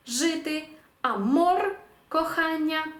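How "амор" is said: «амор» modelled as a-MOR, pitched up; final-syllable stress. Shown as a demonstration. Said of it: "останній склад".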